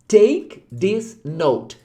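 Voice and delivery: drawn-out, careful English words; very slow model phrase. Shown as a demonstration. Teacher repeats the phrase slowly for students. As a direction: stretch the phrase out. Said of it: "Olha aqui".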